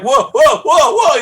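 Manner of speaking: high, loud startled cries of whoa; alarmed whoa. Retold or imitated. Drunk man tipping into the tub, yelping high and loud. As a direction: yelp it as you tip over.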